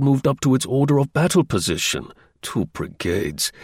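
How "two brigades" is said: slow, quiet, scornful repeat; slow scornful echo. A narrator's reading. Spy scoffing at British troop numbers, slow and scornful. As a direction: let the words drop in slow contempt. Narrator scoffs at small force.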